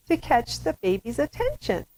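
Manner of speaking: put-on high cooing baby-directed voice; baby-talk pitch. Shown as a demonstration. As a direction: catch a baby's attention with a high voice. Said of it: "higher pitched voice".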